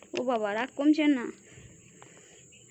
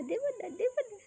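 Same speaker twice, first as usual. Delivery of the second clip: teasing singsong; 10 semitones higher than usual. Acted. Teasing a pet with food.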